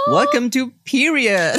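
slow, high, loud host welcome; showy welcome. Acted. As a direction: announce the show with flair. Host opens podcast.

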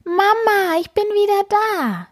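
overjoyed to see mother; happy kitten call. A narrator's reading. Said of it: "glücklich". (glücklicher, hoher Ruf)

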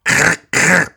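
imitated rattling raven croak. A narrator's reading. Peasant presses the raven's head, imitated croaking. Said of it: "dass er quakte und krr, krr machte".

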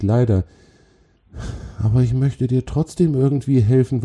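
high, apologetic troll voice; friendly troll. Acted. Troll offers the spider help and introduces himself, apologetic. Troll figure speaks in character.

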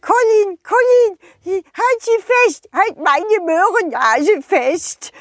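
high, pleading snowman voice; a small snowman begging for help; little snowman voice. Acted. Schneemann calls out high. (hohe, flehende Schneemannstimme)

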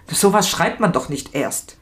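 plain reading voice

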